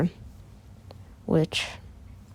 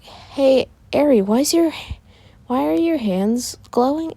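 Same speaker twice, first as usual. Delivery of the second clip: put-on high-pitched young sister voice; questioning sister; 7 semitones higher than usual. Izuka asks Eri about her glowing hands, high and puzzled. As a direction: ask in a raised, puzzled pitch.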